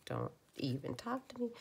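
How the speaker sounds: a high, mock-sulky dog voice that drops off at the end; grumpy dog voice. Owner voicing her grumpy dog in a light, mock-sulky voice. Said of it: "that's how she was feeling".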